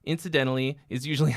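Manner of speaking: ordinary delivery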